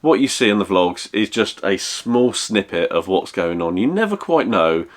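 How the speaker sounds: usual speaking voice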